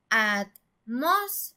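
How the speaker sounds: atmósfera in slow syllables, "mos" stretched and rising; stress on third-to-last syllable. Shown as a demonstration. Teacher splitting atmósfera into syllables, "mos" held and stressed. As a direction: lean slowly on the "mos" in atmósfera. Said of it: "suena más fuerte en la antepenúltima".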